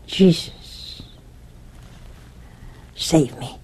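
hushed, breathless whisper; frightened man whispering for rescue; frightened whispered plea. Retold or imitated. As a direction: whisper the prayer as if terrified. Terrified man begs for rescue.

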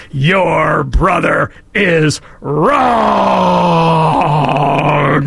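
very slow, stern shouted rebuke; imperious shout. Retold or imitated. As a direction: thunder it down at the child. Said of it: "raised her voice, and said in an imperious tone".

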